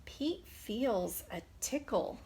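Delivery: ordinary delivery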